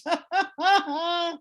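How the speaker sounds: a short, high burst of real laughter. Real, not acted. Laughing while telling friends about a useless medium. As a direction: laugh at how silly it was.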